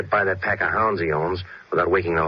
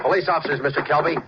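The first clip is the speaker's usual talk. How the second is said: higher, projected call across the yard; 9 semitones higher than usual. Acted. Detective calling across the yard to the suspect, projected. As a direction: announce yourselves from a distance.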